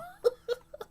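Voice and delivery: a high, squeaky laugh. Real, not acted. Laughing at herself for rushing the live chat along. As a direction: let it squeal up.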